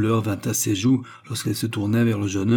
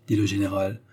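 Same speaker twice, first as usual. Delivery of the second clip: usual speaking voice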